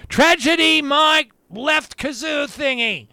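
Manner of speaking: loud, high, hammed-up "Tragedy" with a laugh at the end; mock pronunciation. Host mocking the clip's pronunciation of "Tragedy", loud and high. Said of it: "that's how they pronounce it".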